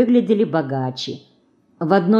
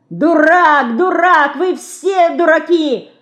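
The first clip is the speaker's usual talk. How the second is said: put-on high, loud girl's taunt; shouting; 9 semitones higher, 4 dB louder, slower than usual. A narrator's reading. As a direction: yell insults high from the fence. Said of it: "кричала".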